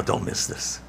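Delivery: preacher urging the congregation, nearly whispered; urgent hush. Acted. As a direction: lean in and plead quietly. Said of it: "hear me now".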